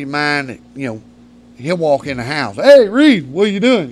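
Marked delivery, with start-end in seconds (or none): 2.6-3.9 high, loud hollered greeting; imitating a loud pal walking in; boisterous buddy. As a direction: burst in hollering hello. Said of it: "You know, kinda guy".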